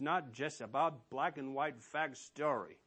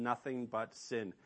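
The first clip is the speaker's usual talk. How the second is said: normal talking voice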